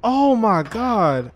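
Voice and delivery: high, slow, stretched-out wonder; amazed exclamation. Real, not acted. Gamer still amazed at the play, drawn-out exclamation. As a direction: draw each word out in amazement.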